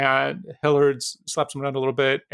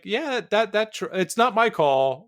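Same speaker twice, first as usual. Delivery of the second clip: higher-pitched, hesitant cop backing off; deferring cop; 6 semitones higher than usual. Acted. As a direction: stumble through handing off the decision. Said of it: "the cops are like".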